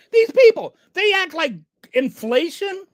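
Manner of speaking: sudden high, loud burst; exasperated outburst. Real, not acted. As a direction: blurt it out, fed up. Exasperated rant.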